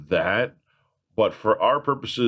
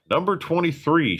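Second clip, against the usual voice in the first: louder; 8 semitones higher than usual. Real, not acted. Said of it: "That wasn't loud enough".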